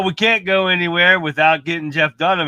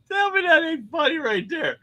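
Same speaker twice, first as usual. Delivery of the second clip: laughing; 14 semitones higher, 5 dB quieter than usual. Real, not acted. Host cracks up at video.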